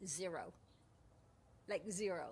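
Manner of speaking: normal talking voice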